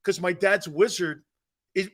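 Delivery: ordinary talk, nothing marked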